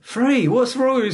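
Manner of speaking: high, loud exasperated outburst; acted quarrel, voice shot right up; mock exasperation.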